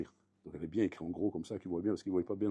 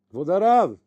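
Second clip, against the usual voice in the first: louder call, pitched higher, to a deaf rabbi; 5 semitones higher, 11 dB louder, slower than usual. Retold or imitated. Appel plus fort au rav sourd. (appeler plus fort le rav sourd)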